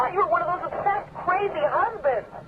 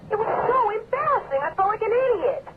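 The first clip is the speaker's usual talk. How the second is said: upset crying; 6 semitones higher than usual. Acted. Wife upset after embarrassing scene.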